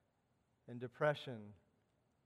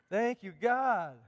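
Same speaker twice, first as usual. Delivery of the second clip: slow, louder heartfelt thanks; slow ringing thanks; fervent praise; 7 semitones higher, 8 dB louder, slower than usual. Retold or imitated. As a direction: lift it up with joy. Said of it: "natural it will be to go".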